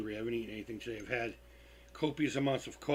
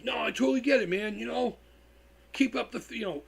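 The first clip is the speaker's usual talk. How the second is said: Dime's voice; 8 semitones higher, 4 dB louder than usual. Retold or imitated. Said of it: "So, he".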